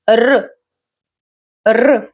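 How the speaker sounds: trilled Russian R sound; rolled R. Shown as a demonstration. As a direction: roll the R as an isolated sound. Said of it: "manage to roll this letter".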